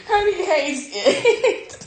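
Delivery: high, slow laughing coo. Real, not acted. Cooing at the baby.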